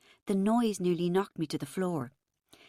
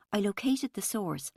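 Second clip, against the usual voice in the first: ordinary delivery, nothing marked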